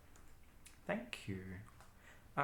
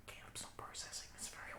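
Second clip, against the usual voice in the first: whispered aside; hushed, breathy aside; 14 dB quieter, faster than usual. Real, not acted. Aside to the table.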